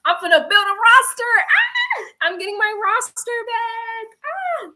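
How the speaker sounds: squealing high, hyped up; celebrating being back, loud and high; hyped celebration. Retold or imitated. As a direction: gloat like you're back on top. Celebrating getting roster back.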